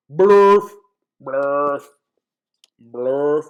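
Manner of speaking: booming, goofy nonsense noise; loud nonsense blurt. Acted. Reader must read whatever the book says, loud silly blurts. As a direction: belt out each silly word separately.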